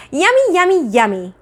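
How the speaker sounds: put-on high, loud sing-song baby voice; gleeful toddler. Fudge taunting mother about eaten flowers, gleeful toddler chant. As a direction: chant it like a delighted little kid.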